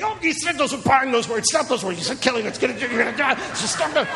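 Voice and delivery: high, hammed-up scold over spoken words; mock scolding faith preacher. Preacher mimics faith friends scolding a sick man, high mocking voice. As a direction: nag him to take back his words.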